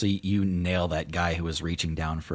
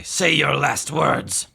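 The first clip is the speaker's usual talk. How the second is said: threatening adventurer; 9 semitones higher, 9 dB louder, much slower than usual. Acted. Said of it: "they say".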